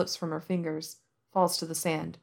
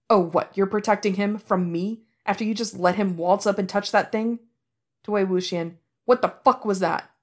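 angry snap; 2 semitones higher, 5 dB louder than usual. Acted. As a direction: demand it furiously. Angry confrontation.

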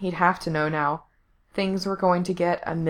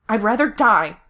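loud, higher-pitched defiance; defiant outburst; 4 semitones higher, 6 dB louder than usual. A narrator's reading. Captive shoves his tormentor away, defiant. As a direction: throw it at him loudly.

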